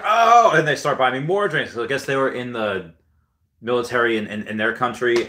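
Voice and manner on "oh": high, loud "oh" of delighted recognition; impressed cheer. Retold or imitated. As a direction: mimic a pub group's excited reaction. Foreign guys learn he's military.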